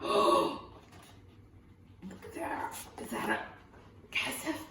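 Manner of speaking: breathless hushed wonder after a gasp; gasp, then whisper in amazement; awed whisper. A narrator's reading. Gasp at discovery in cave.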